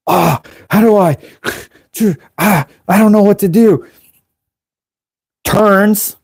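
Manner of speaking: high, gasping panicked voice; acted panic. Novice at the tank controls, panicking.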